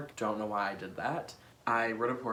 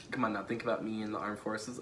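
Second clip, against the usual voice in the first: normal talking voice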